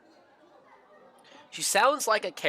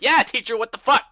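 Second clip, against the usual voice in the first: high, loud mock rallying cry; retold group outburst; 7 semitones higher, 8 dB louder than usual. Player imitating everybody backing up the challenge, loud mock outrage. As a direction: imitate a whole class chiming in loudly. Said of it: "And everybody's like".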